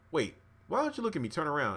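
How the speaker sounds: high, quick puzzled demand; retold suspicion. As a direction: act out sudden suspicion. Retold woman growing suspicious of man.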